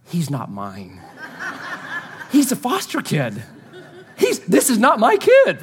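half-joking plea to the crowd, laughing; laughing protest. Retold or imitated. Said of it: "I wanted to say to everybody".

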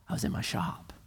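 "I was in my shop" whispered, as if it dawns; awed whisper. Shown as a demonstration. Preacher whispering the line as a grateful realization. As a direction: breathe it out in wonder. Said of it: "Think about a year ago".

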